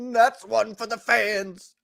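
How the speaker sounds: theatrical star delivery, drawn out; William Shatner. Acted. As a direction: play to the fans, stretching each word. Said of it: "the Shatner and the Tsoukalos ones".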